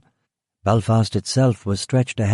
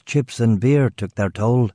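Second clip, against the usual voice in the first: plain reading voice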